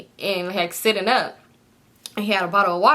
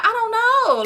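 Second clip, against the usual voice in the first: squeaky; 13 semitones higher, 3 dB louder, much faster than usual. Retold or imitated. Squeaky helpless answer to mom.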